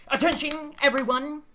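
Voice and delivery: high, booming announcement; booming announcer voice. Acted. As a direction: call it out like a PA announcer. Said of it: "you can hear some booming voice".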